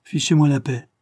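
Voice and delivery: a testy retort to a nagging colleague; irritated. Acted. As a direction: brush him off in annoyance. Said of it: "lui jeta Paul Bart avec humeur".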